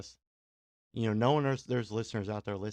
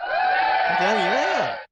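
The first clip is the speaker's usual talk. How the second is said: loud, slow, hyped shout of conviction; hype shout; 8 semitones higher, 11 dB louder, much slower than usual. Real, not acted. To his listeners, shouting his love of the festival. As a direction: belt it out like a DJ hyping the crowd.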